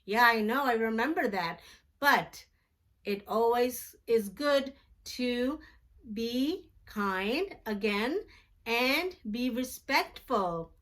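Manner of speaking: plain reading voice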